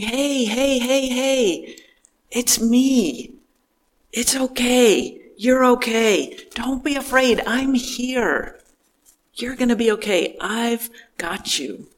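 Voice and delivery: put-on calm a frightened child gently; tender parent. Parent soothing a frightened child, soft and tender. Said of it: "Like a parent might say to a frightened child".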